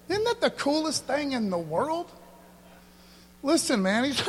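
usual speaking voice

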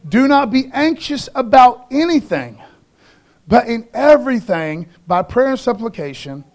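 loud, driving scripture recital; preaching at full force. Real, not acted. Pastor thunders the verse at the congregation, preaching loud. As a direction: proclaim the verse to the crowd.

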